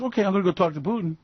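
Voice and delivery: fast, huffy mock Trump; quick offended comeback; imitating Donald. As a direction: rattle it off in a sulk. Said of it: "And Donald goes".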